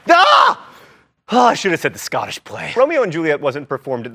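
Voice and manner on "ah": startled scream. Acted. Said Macbeth in a theater.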